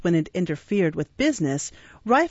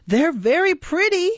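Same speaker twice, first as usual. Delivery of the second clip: Charlie Bates; 11 semitones higher, 3 dB louder than usual. Acted. Charlie mocks Oliver.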